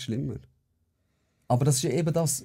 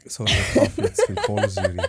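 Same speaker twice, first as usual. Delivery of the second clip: laughing apology; 3 semitones lower, 5 dB louder than usual. Real, not acted. Apologises for fizz amid laughter.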